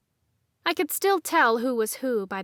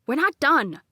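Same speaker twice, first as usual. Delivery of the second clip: scolding; 4 semitones higher, 6 dB louder than usual. A narrator's reading. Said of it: "Grant scolded".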